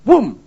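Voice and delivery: loud booming blast; a preacher mimicking an angry outburst exploding; explosion sound. Shown as a demonstration. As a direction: burst out the word like an explosion. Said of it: "Jadi dia meledak, meletus".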